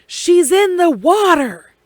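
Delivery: loud, high yell across the scene; officer yelling to his partner, high and loud; retold scream. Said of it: "So he screams".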